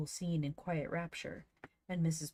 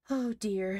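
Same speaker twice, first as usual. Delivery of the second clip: higher, louder exclaimed sigh; 5 semitones higher, 6 dB louder than usual. A narrator's reading. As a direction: sigh it out, overcome. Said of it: "sighed Joel".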